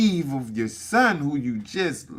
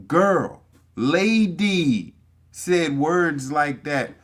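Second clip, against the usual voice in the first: ordinary talk, nothing marked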